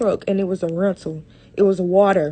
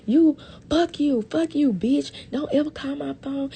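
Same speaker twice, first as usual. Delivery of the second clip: mock furious cussing; 6 semitones higher than usual. Mother cussing out her son's girlfriend: mock furious rant. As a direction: ham up the mother's cussing, pitched up.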